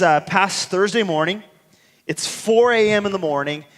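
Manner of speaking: usual speaking voice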